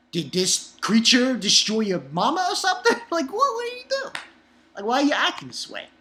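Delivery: high-pitched mock interrogation; incredulous. Reader questioning Cohen's motives, exasperated and incredulous. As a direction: climb into squeaky, baffled protest.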